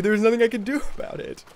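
ordinary talk, nothing marked

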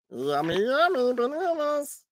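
cartoon meatball voice; Meatwad. Streamer testing a cartoon voice on a banana. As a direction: squeeze out a silly cartoon voice. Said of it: "this kinda sounds like Meatwad".

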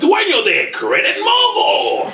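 drawn-out shouted guest intro; lucha libre announcer. Acted. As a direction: stretch the name like a ring emcee.

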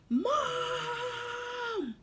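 high crying holler; hollering for mom. Acted. Baby llama hollering downstairs for his mama. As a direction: holler for mom like a wailing toddler. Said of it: "hollers loudly for his".

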